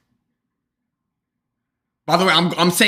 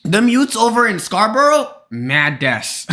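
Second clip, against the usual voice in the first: imitated Toronto slang accent, pitched higher; 6 semitones higher than usual. Streamer mimicking the Toronto clip for chat, playful Toronto accent. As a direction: put on a high-pitched mock Toronto accent.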